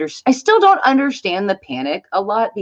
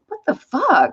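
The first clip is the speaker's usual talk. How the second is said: jumps much higher, alarmed disbelief; shocked exclamation; 11 semitones higher than usual. Retold or imitated. Mom growing panicky at the store, higher shocked exclamation. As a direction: blurt it out, startled. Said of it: "I'm like".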